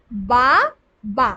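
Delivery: "বা, বা" with a clear gap after each syllable. Shown as a demonstration. Teacher counting syllables for pupils, "বা, বা" said apart. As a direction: say each বা on its own, slowly. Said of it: "এখানে কটা দল আছে".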